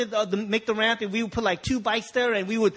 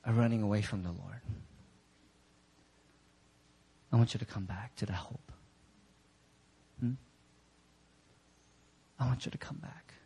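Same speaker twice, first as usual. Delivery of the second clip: low, soft, earnest appeal; hushed pleading; 11 semitones lower, 7 dB quieter than usual. Real, not acted. Preacher invites those running away to come back, hushed and earnest. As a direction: drop the voice to draw them back gently.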